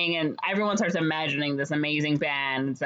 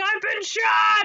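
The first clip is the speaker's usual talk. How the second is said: high, drawn-out shriek of alarm; hammed-up scream, loud and slow; mock scream; 5 dB louder, slower than usual. Mayor's wife after the firecracker goes off, mock screaming. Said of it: "she's screaming".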